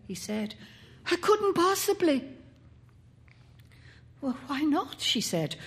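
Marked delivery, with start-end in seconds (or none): 1.1-2.2 high, pleading protest; urgent refusal. A narrator's reading. Boy begs his mother to stay, urgent refusal. 4.2-5.0 higher, mildly put-out query; questioning. A narrator's reading. Mother questions her son's refusal, mildly put out.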